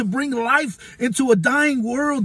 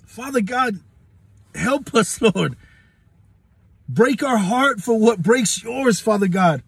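prayer. Acted. Preacher praying for his listeners, earnest prayer.

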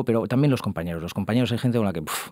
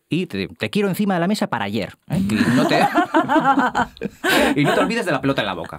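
laughing mock seduction; 6 semitones higher than usual. Boss erotic role-play parody.

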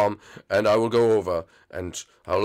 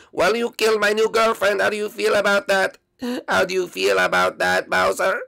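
high-pitched, aggrieved confrontation; accusing; 9 semitones higher, 3 dB louder than usual. Acted. Bereaved boyfriend confronts his girlfriend's killer, accusing. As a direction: throw the blame in a high, strained voice.

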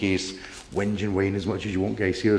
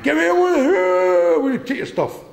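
much higher, louder, slow menacing caricature of a bailiff; mock bailiff; 5 dB louder, slower than usual. Imitating a bailiff bullying his wife at the door, higher, louder and slow. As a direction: ham up a shrill, dragged-out bailiff demand.

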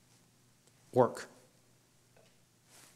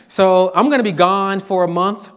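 higher, louder student voice with laughter; mock whiny student; 6 semitones higher, 5 dB louder than usual. Professor imitates students asking to miss class, mock whiny. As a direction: play a student angling to skip class, half laughing.

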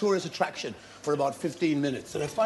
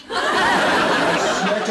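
laughing; 6 semitones higher, 7 dB louder than usual. Real, not acted. Laughing while telling the story.

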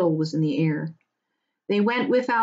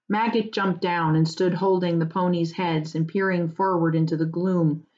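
plain reading voice

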